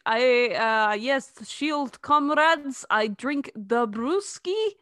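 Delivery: slow, hammed-up Russian accent; drawn-out put-on Russian accent; mock Russian accent. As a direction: play a halting Russian spy posing as American. Joins in the Russian spy bit.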